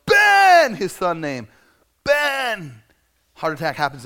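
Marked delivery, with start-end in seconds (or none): 0.1-0.8 high, loud shout for his son; yelled at full voice; desperate yell. Retold or imitated. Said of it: "and he yells". 2.1-2.8 loud, high call falling away; retold yell for his son; desperate yell. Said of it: "and he yells".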